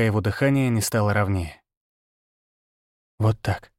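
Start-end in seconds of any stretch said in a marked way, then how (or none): 3.2-3.7 hushed, careful reassurance; whispered. A narrator's reading. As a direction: murmur it so as not to spook the animal. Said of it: "прошептал я, едва шевеля губами".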